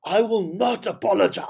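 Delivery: mock her defiant refusal in a put-on voice; Helen Zille impression. Host mimicking the politician refusing to apologize, lightly voiced impression. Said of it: "I can't do her voice. It hurts my throat".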